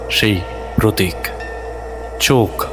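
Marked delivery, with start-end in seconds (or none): none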